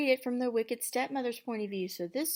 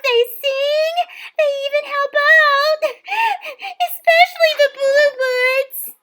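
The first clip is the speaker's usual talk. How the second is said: put-on much higher, excited young girl; chirpy girl voice; 17 dB louder than usual. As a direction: squeak it like a dreamy girl. Cindy's voiced lines.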